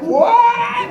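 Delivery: high shocked yelp; shocked shout. Retold or imitated. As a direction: shriek it in disbelief. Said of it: "And they're like".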